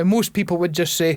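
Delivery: usual speaking voice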